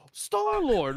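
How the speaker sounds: high, loud gasping Star-Lord; a breathless hero's reveal; gasped awestruck name. Retold or imitated. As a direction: gasp it out in awe, loud and high.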